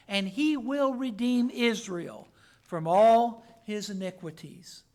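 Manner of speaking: loud, measured psalm reading; solemn, emphatic scripture read. Acted. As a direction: proclaim the verses to the congregation. Reads psalm aloud in sermon.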